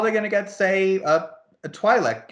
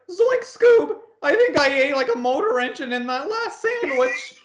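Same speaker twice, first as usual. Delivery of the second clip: Shaggy; 10 semitones higher than usual. Acted. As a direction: yelp it like a goofy cartoon sidekick. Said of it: "like, Shaggy".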